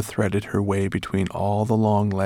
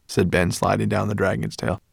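ordinary delivery, nothing marked

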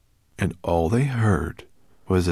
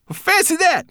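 much higher, loud mocking creature; mocking brownie cry; 6 dB louder than usual. A narrator's reading. Scornful brownie greets the dragon, high and loud. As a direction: squeak it out scornfully. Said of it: "he cried".

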